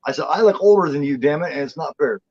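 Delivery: ordinary talk, nothing marked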